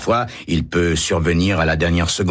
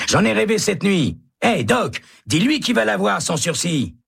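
calling out. A narrator's reading. Hennessy calls to Doc.

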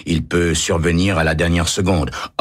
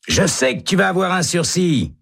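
high insistent reassurance; hopeful. A narrator's reading. Hennessy reassuring Baca, high and eager. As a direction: convince him it will come. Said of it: "dit Hennessy".

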